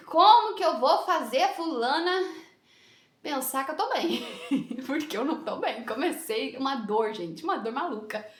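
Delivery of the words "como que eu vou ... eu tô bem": drawn-out, strained higher fretting; retold worry. Woman in the elevator wondering how to hide pain, worried. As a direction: act out a panicky question to yourself. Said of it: "eu já tava assim, ó".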